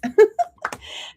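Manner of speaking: high burst of laughter; real laughter. Real, not acted. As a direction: giggle high at your own slip. Laughing at her own name slip.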